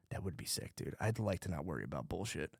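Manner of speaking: low, very soft confession; quiet inner voice. Acted. Part of him wishing aloud, quiet and low. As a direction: voice a private thought, dropped right down. Voicing a private part of himself.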